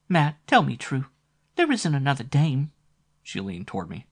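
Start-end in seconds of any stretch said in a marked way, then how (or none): 0.1-2.7 high, pleading sultry woman; sexy voice. Acted. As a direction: ask it jealously. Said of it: "Flo's sexy voice".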